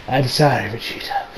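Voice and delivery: low, hushed, fading to a whisper; subdued apology. Retold or imitated. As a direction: apologise softly under the breath.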